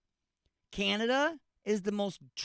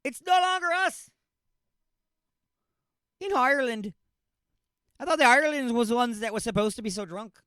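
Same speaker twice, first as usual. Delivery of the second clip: higher, louder bad Irish accent; horrible Irish accent; 6 semitones higher, 5 dB louder than usual. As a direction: ham up a terrible Irish accent. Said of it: "that's a horrible accent".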